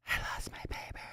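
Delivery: whispered under breath; breathy unvoiced whisper. Real, not acted. Whispered repeat of lost paper.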